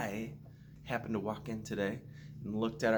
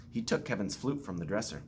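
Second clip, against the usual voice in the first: plain reading voice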